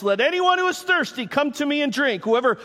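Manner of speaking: high-pitched preacher's call; loud proclamation. Acted. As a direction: proclaim it to the crowd, pitched well up. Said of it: "said in a loud voice".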